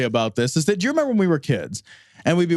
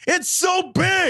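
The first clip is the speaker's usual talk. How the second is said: higher, slow amazed exclaim; slow, higher cry of disbelief at the size; 11 semitones higher, much slower than usual. Real, not acted. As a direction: stretch it out in disbelief. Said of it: "marvelling at book size".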